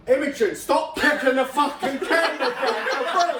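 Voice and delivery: exasperated telling-off, laughing through it; mock scolding. Telling Imogen off for kicking the camera, mock scolding.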